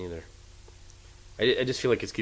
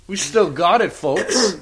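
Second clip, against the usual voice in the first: hammed-up host boast; 8 semitones higher, 6 dB louder, much slower than usual. Hosts hamming up a segue.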